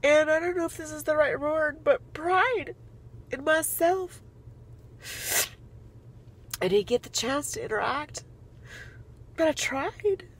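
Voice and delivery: teary, emotional voice; holding back tears; tearful. Real, not acted. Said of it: "I'm crying so much".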